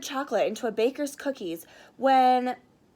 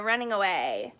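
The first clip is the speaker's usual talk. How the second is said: plain reading voice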